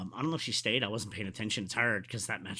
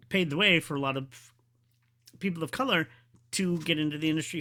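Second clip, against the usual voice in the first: ordinary talk, nothing marked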